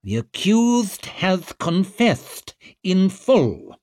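drawn-out public declaration; official proclamation. A narrator's reading. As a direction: proclaim it over a noisy court. Official answering an angry courtroom.

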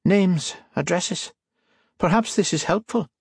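high, thin, sly Irish voice; reedy Irish man. A narrator's reading. Buyer asks the priest, high and reedy Irish.

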